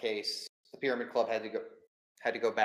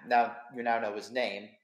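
normal talking voice

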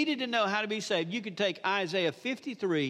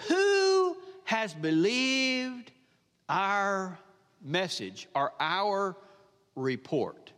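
emphatic scripture reading; much slower than usual. Acted. Preacher repeating the verse so the congregation listens, emphatic scripture reading. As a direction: draw each word out, pausing between phrases.

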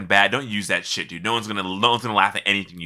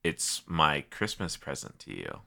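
lower mysterious offer voice; 6 semitones lower, 8 dB quieter than usual. Pretend. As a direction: deliver it like a secret deal. Grand mock offer to friend.

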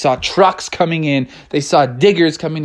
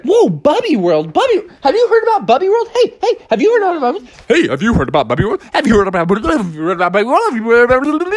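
high, fast, overlapping gossip; excited chatter; 8 semitones higher, much faster than usual. Acted. Neighbors buzzing about Bubby World, high and fast. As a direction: babble it over and over.

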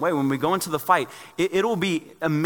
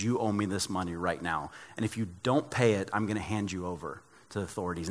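quiet threat; 4 semitones lower, 6 dB quieter than usual. Retold or imitated. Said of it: "grabs him by the neck and says".

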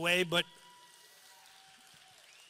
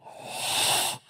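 last breath gasp. Retold or imitated. Imitating dying father's breath.

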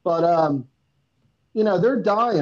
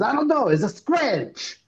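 a light imitation of a Ghanaian accent, higher start; heavy Ghanaian accent; 8 semitones higher than usual. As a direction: lightly voice a Ghanaian soldier on the radio. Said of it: "he had this heavy accent".

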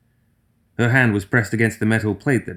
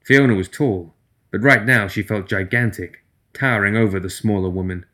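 ordinary delivery, nothing marked

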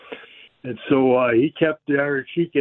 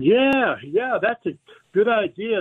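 higher, keen yeses of a boss won over; eager agreement; 8 semitones higher than usual. Retold or imitated. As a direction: play the boss suddenly sold on the idea.

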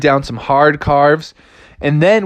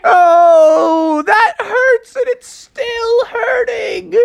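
high, drawn-out cartoon crying; whining wail; slower than usual. Shark chopped on his bruise, tearful wailing. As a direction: wail in mock agony, very high and stretched.

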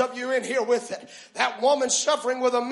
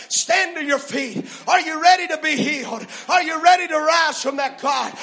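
normal talking voice

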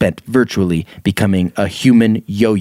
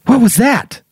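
startled question; 11 semitones higher, 3 dB louder than usual. A narrator's reading. Reading dialogue in a story.

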